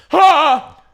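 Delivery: loud high shriek of surprise; girl slapped in line shrieks in shock; startled shriek. Retold or imitated. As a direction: yelp like you got slapped from behind. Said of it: "she just goes".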